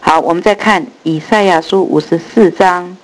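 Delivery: ordinary delivery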